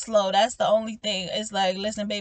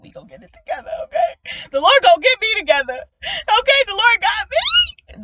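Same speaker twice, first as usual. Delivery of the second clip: carefree exclaim; 11 semitones higher, 9 dB louder than usual. Retold or imitated. Acted carefree voice.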